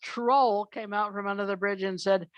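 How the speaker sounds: usual speaking voice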